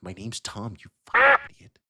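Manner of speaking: rising into a loud, high, fast snap; angry correction. Acted. Elf snaps at the guard over his name, loud and high. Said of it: "he's like".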